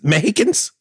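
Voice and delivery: Mexicans said meh-HEE-cans, high and questioning; imitating Magic saying Mexicans; Magic's Mexicans. Shown as a demonstration. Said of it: "You know who else says".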